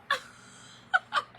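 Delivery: high breathless laugh with tears; real laugh breaking into crying. Real, not acted. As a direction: let a teary laugh escape. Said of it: "one minute I'm laughing".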